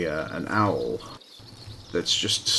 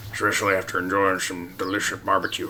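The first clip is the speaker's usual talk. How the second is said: a fast, put-on cartoon voice; Scruffy voice; faster than usual. Gamer doing his one character voice for viewers. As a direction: rattle it off in a silly character voice. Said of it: "The only voice I can do is Scruffy's".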